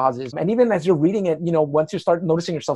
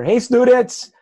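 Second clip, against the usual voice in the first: higher, louder mock hail to listeners; put-on chirpy radio-style greeting; playful shout-out; 7 semitones higher, 6 dB louder, much slower than usual. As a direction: call out to the students, hamming it up.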